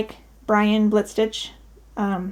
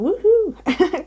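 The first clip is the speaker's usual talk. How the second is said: slow, high, drawn-out woohoo; mock cheer; 9 semitones higher than usual. Real, not acted. Telling viewers about the anniversary, drawn-out celebratory cheer. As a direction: stretch the cheer out playfully.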